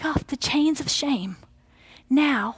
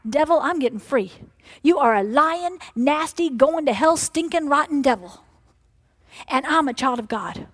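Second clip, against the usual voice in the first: rebuking the devil; 2 semitones higher than usual. Acted. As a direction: tell the devil off with force.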